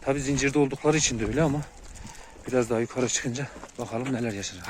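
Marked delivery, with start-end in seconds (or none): none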